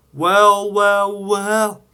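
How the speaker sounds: high, loud, fading drawn-out wells; exclaimed. Acted. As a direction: savour the discovery gleefully. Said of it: "he exclaimed".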